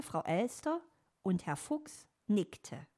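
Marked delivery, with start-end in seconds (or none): none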